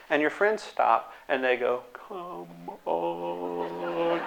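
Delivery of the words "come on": much softer, stretched come on; slow motion. Retold or imitated. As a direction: drag the words like a slowed-down tape. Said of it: "in slow motion".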